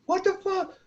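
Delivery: squeaky high disbelief; baffled. Retold or imitated. Squad baffled again.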